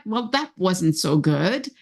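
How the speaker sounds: usual speaking voice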